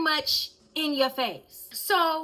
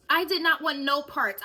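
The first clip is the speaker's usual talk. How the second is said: usual speaking voice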